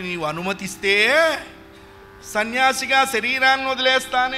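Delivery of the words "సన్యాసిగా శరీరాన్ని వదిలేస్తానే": loud, high earnest plea; pleading son. Acted. Boy begs his mother for permission, loud pleading. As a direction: beg your mother with all your heart.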